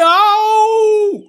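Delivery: father yells as the trap bites; pained yelp. Acted.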